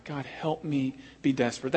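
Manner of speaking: soft, earnest plea to God; hushed prayer. Acted. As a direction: pray it quietly, pleading. Voicing his own quiet prayer.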